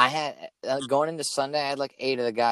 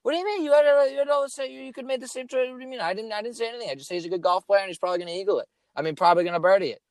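much higher, fast defensive protest; 10 semitones higher, faster than usual. Retold or imitated. Son defending his pick to his dad, high and rushed. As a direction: plead your innocence in a rushed high voice. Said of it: "I'm like".

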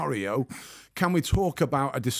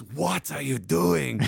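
menacing clamper; 3 semitones higher, slower than usual. Retold or imitated. Clamper confronts him.

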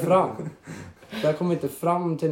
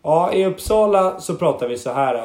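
slow, a bit higher and louder, lightly mimicking father; slow and a bit raised, a light take on dad's Uppsala speech; a mild impression of dad, slowed and raised; imitating dad from Uppsala; 4 semitones higher, 4 dB louder than usual. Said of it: "om man ska prata som min pappa så är det så här".

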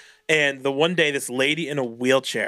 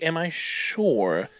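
mock indignant; much slower than usual. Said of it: "I'm gonna be like".

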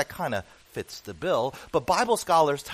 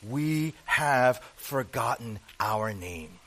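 slow, lower, strange creature croak; Gollum; 5 semitones lower, much slower than usual. Retold or imitated. Preacher quoting Gollum to his congregation, slow creature voice. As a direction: drag each word like a wretched creature. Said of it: "I can't do the Gollum impersonation".

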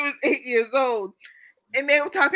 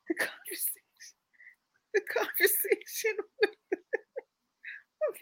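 words breaking up in giggles; trying to talk but cracking up; real giggling fit over the sentence; laughing through words; 9 semitones higher, 6 dB quieter, slower than usual. Real, not acted. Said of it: "Why are you laughing at that?".

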